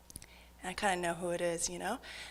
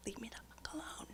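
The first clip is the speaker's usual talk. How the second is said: fast whispered punchline; fast, whispered under-the-breath aside; 3 semitones higher, 19 dB quieter, faster than usual. Retold or imitated. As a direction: hiss it quickly under breath. Punchline of sorry note.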